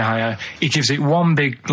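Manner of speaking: ordinary delivery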